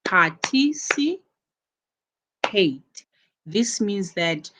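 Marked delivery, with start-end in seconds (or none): 0.0-1.2 "Participate" split up, par-ti-ci rising high, then a gap before pate; syllable by syllable. Shown as a demonstration. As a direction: lift the early syllables of "Participate" and set the last apart. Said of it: "Which one is going to take the stress?".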